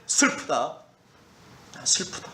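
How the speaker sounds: soft, grieving sigh of sorrow; sorrowful lament. Acted. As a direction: let the sorrow fall away into breath. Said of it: "말씀하시되". (슬픔에 잠긴 탄식)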